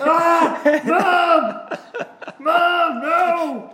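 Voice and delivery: high, loud hammed-up yelp; mock yelping kid. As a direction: whine like a kid pulled by the ear. Son yelping as mom grabs ear.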